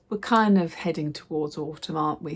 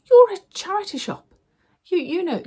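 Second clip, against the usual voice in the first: high and louder, exasperated; indignant; 12 semitones higher, 7 dB louder than usual. Retold or imitated. Telling off the charity shop man, high and indignant. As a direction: lecture him in high, exasperated outrage.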